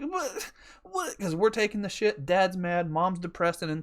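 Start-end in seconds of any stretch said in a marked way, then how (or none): 0.0-1.2 high, small imitation of upset children; mock crying kids. Kids watching their stuff get taken, mock crying. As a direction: squeak like a bewildered, tearful kid. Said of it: "these kids have come out".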